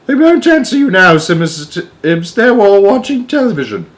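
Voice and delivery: very high, loud know-it-all nanny; stern old nanny. Acted. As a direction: put him right like a bossy old nurse. Miss Tibbs corrects the president.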